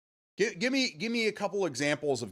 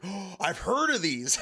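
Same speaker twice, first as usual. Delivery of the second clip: gasping, drawn-out imitation of a kid; mock wonder, pitched up; awed kid; 7 semitones higher, slower than usual. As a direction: imitate a kid in slow, high awe. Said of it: "this one kid just comes to me".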